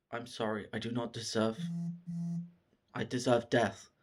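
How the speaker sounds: hushed, subdued apology; soft remorse. Retold or imitated. Demon kneeling before his dead trainer, soft remorse.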